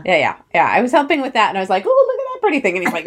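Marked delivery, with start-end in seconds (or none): 1.9-2.8 gushing. Retold or imitated. Wife gushing at scenery.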